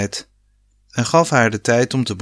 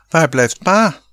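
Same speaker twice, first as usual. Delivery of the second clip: high, louder call across the pool; 6 semitones higher, 4 dB louder than usual. A narrator's reading. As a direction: shout for dad to come. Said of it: "riep Rob". (luid en hoog roepend)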